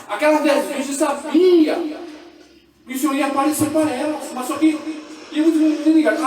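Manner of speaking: loud, high preaching at full force; fiery preaching. Real, not acted. Preacher shouting the truth to the church. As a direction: proclaim it to the congregation.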